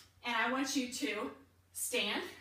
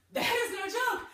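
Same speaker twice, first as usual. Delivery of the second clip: high, loud and amazed; excited exclamation; 12 semitones higher, 4 dB louder than usual. Real, not acted. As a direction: burst out in surprised disbelief. Reacting to a hard exercise.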